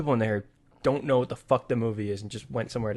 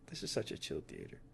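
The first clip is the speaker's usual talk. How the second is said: soft breathy half-whisper, much quieter; hushed aside; 7 semitones lower, 17 dB quieter than usual. Retold or imitated. Recalling an empty, calm screening, hushed murmur. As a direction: murmur it like a content secret.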